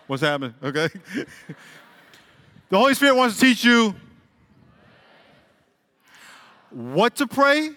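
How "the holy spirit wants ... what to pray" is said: preacher hammering it in; emphatic preaching. Real, not acted. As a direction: drive the point home.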